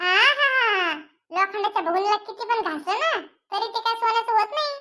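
a tart wife putting her husband in his place; mother's voice. Acted. Mother mocks father.

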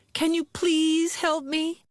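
high, slow little plea; pleading bird voice. Acted. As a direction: beg for help in a small high bird voice. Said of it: "Nightingale asks".

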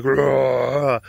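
loud imitated growl; mock predator roar; mean big-cat snarl. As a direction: roar like a cat guarding food. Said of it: "And she got mean".

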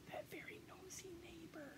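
a secretive stage whisper about the neighbor; whispered aside. A narrator's reading. Storyteller whispering to the kids, a sly whispered aside. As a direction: lean in and whisper it like gossip. Narrator's gossipy aside to children.

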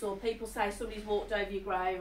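usual speaking voice

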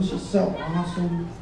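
normal talking voice

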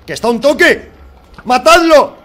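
very high, loud urgent yell; grito eufórico. Real, not acted. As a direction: scream for the kill. El jefe casi muerto. (grito desesperado pidiendo que lo maten)

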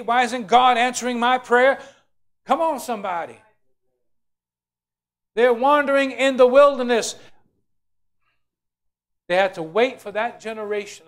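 loud, high-pitched sermon cry; preaching at full force. Acted. Preacher rousing the congregation about complainers, loud and high. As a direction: drive it home to the crowd.

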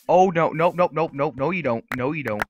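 loud, high string of nopes; alarmed refusal. Real, not acted. Gamer stopping an enemy's escape, loud refusal. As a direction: fire off loud rapid denials.

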